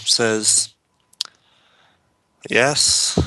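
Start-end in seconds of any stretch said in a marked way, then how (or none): 2.5-3.2 curt innkeeper, lightly acted; a touch of grumpy impatience; foul mood. Innkeeper answering an adventurer in a foul mood, mildly grumpy. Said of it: "Seems in a rather foul mood".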